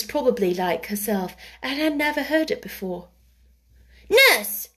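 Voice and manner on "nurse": put-on high, loud imperious child; commanding. A narrator's reading. Mary summons the nurse, commanding. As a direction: call out a sharp order. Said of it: "she commanded".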